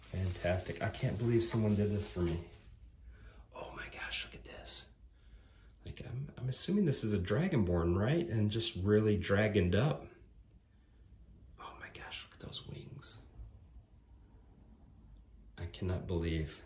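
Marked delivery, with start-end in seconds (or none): 3.6-6.0 hushed, unvoiced-breath whisper of amazement; awed whisper. Real, not acted. Awed look at the miniature. 11.6-13.2 hushed, unvoiced-breath whisper of amazement; awed whisper. Real, not acted. Awed look at the miniature.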